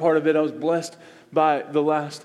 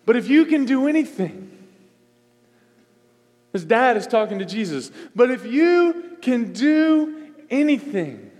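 pleading father; 6 semitones higher, 4 dB louder than usual. Acted.

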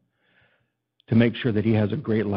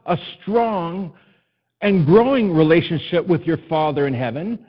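raised, ringing pulpit voice, pressing each point home; preaching; 5 semitones higher, 4 dB louder than usual. Acted. Pastor exhorting his congregation, full preaching voice. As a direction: preach it out to the whole room, higher and louder.

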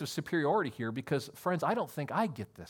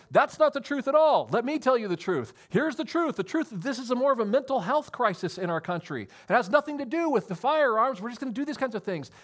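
voicing the other side; 5 semitones higher, 4 dB louder than usual. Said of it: "another side saying".

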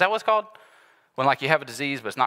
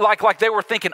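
usual speaking voice

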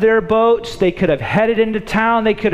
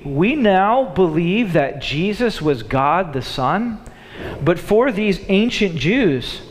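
ordinary talk

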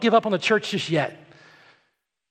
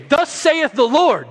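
higher, slow, lofty pronouncement; pompous Pharisee; 6 semitones higher, 4 dB louder, slower than usual. Retold or imitated. Preacher mocking the Pharisees, slow lofty decree. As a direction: proclaim it like a self-important Pharisee. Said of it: "they would say".

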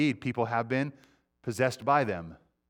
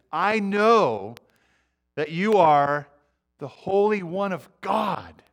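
demon declaring; 4 semitones higher, 4 dB louder, much slower than usual. Acted. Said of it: "then the statement".

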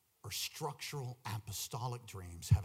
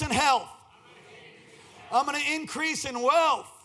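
louder, high declarations for the crowd to repeat; call-and-response preaching; 11 semitones higher, 11 dB louder than usual. Real, not acted. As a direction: lead the crowd in loud faith declarations.

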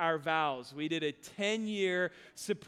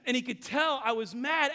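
ordinary talk, nothing marked

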